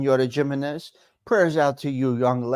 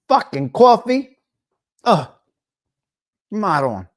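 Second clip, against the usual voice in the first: annoyed outburst; 5 semitones higher, 5 dB louder than usual. Real, not acted. Burned by hot coffee.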